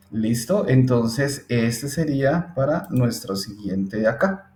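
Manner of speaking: ordinary delivery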